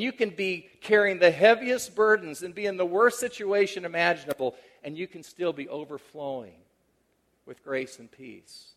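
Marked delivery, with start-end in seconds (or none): 4.8-8.7 hushed echo. Acted. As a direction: drop to a near hush so it sinks in. Theme repeated as a hushed echo.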